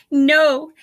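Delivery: loud, high gasp of a word; loud gasping no from a laughing rhino. A narrator's reading. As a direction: blurt it out between laughs. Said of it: "gasped rhinoceros".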